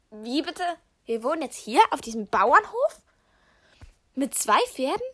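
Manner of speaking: loud, astonished questions; stunned disbelief. Real, not acted. Surprised at news of farm.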